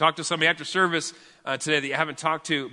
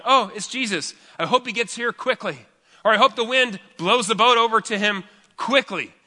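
voicing disciples; 7 semitones higher, 5 dB louder than usual.

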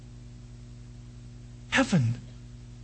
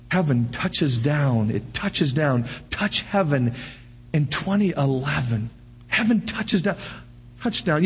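preaching refrain; 7 semitones lower, slower than usual. Acted.

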